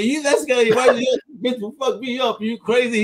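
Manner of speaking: high, amazed retelling; laughing disbelief. Retold or imitated. Host reliving the drum sale joke, high amazed protest. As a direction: squeal it through disbelief at the buyer. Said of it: "I'm like".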